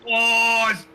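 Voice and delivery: sudden loud gasp of shock; surprised shout. Real, not acted. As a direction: yelp at the crash. Crash seen live on track.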